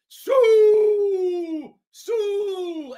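high, loud celebratory call. Real, not acted. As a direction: yell the name in joy. Celebrating, calling out a name.

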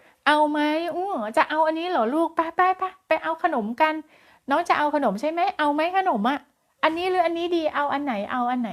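put-on high, bright-toned coaxing questions to a toddler; sing-song coaxing mother. Shown as a demonstration. Teacher demonstrating how a mother coaxes her toddler, high sing-song coaxing. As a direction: coax the child like a cheerful mom. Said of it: "เอานะคะ".

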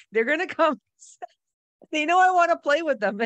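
put-on gleefully insisting to a doubting boyfriend; giddy insistence. Telling her boyfriend the dolphins will come, giddy and laughing.

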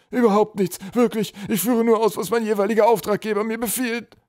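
high, loud troll pleading; whimpering. A narrator's reading. Captured troll swears he only follows orders, whimpering. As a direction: whine out excuses in a high voice. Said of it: "wimmerte der Packtroll".

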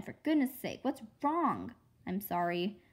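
ordinary delivery

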